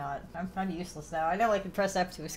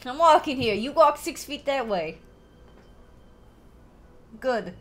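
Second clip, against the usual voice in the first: loud, higher Brooklyn shout; Brooklyn accent; 8 semitones higher, 8 dB louder than usual. Retold or imitated. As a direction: bark it like an angry New Yorker. Brooklyn "I'm walking here" bit.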